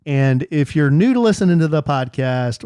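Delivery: ordinary talk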